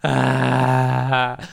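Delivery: loud mock gasp, then drawn-out moan; imitated pained moaning. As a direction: mimic a man groaning in discomfort. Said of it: "half crying, half, like, moaning".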